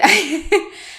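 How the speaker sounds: short, high laugh; high burst of frustrated laughter; exasperated laugh. Real, not acted. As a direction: laugh at your own annoyance. Laughs at own annoyance.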